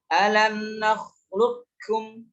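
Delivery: alam nakhluqukum chanted slowly, q merged into k; full idgham. Shown as a demonstration. Teacher demonstrates the better-known reading, nakhluqukum with doubled k. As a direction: intone alam nakhluqukum, going straight to the kaf. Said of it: "langsung masuk ke kaf".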